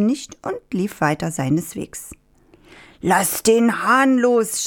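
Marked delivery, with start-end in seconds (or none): 3.0-4.6 slow, high storyteller's shout; shouted demand. A narrator's reading. Farmer chasing the fox with the rooster, shouting. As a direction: stretch out the command with raised pitch. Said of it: "schrie der Bauer".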